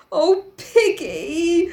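slow, drawn-out stricken moan, pitched high. Acted. Ralph to Piggy after the killing, stricken. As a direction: draw it out in mock anguish. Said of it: "voice was low and stricken".

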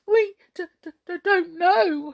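high, loud, stuttering fright; dismayed stammer. A narrator's reading. Brownies answering the crowd, stammering in dismay. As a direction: stammer it out in alarm. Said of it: "stammered the brownies in dismay".

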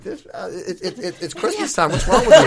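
high, loud disbelief through a laugh; mock exasperation. To the snappy watch clerk, loud laughing disbelief. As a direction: squeal it out half-laughing, outraged.

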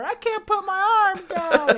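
put-on whiny bundled kid. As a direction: whine like a kid stuck in layers. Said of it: "I say that one all the time".

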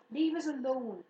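firm loud command. A narrator's reading. Fern orders her brother, loud and firm. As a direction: order him to leave it. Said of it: "commanded Fern".

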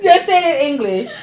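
laughing. Real, not acted.

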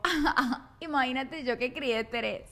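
a touch of amused laughter, slow; imitating grandma chuckling at her daughter; mother laughing on the phone. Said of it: "se rió y me dijo".

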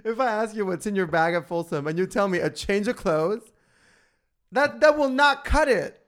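high, loud, laughing indignation; laughing outrage. Real, not acted. Mock outrage at dull answer.

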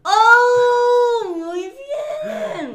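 high, loud delighted shout of praise; excited cheer. Real, not acted. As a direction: cheer the correct answer loudly. Praising a correct guess.

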